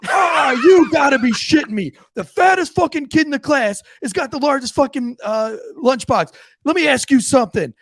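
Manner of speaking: high-pitched sergeant's outraged rant; incredulous yelling. Retold or imitated. Drill sergeant discovering the kid's lunchbox, incredulous yelling. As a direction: explode in disbelief, stretching the opening. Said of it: "he starts yelling".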